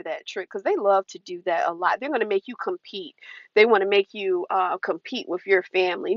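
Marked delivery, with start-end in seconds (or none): none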